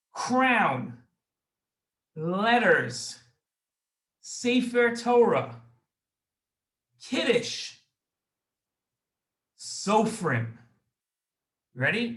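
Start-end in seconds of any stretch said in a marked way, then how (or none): 0.1-10.6 each word alone with long pauses between; slow and deliberate, one word at a time, like dictation; said slowly. Shown as a demonstration. Host reading the memory words to kids, slowly. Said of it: "I'm gonna say them slowly first".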